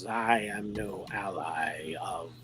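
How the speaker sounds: low, slow alien voice; slow, low and eerie. Acted. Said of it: "this strange sort of alien type voice".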